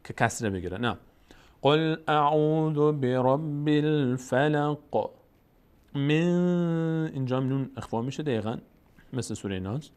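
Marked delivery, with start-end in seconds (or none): 1.6-7.1 قل اعوذ برب الفلق chanted slowly with tajweed; measured tajweed recitation; slow Quran recitation. Shown as a demonstration. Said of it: "recitation after teaching".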